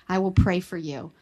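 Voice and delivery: usual speaking voice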